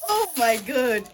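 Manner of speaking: loud, high squeal of delight; overjoyed squeal. Real, not acted. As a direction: shriek with joy at the gift. Overwhelmed opening a gift.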